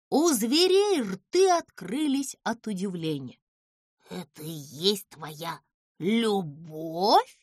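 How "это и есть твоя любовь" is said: low, slow astonished voice; Bubusha blurts. A narrator's reading. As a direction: drag it out in disbelief. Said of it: "не выдержал Бубуша". (низко, медленно, с изумлением)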